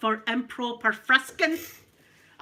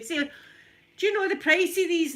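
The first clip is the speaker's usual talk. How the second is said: loud, high-pitched price check; calling out; 8 semitones higher, 6 dB louder than usual. Acted. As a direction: holler it so the whole shop hears. Checkout operator calls for price check.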